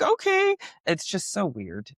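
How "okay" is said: dog voice. Retold or imitated. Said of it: "they're like".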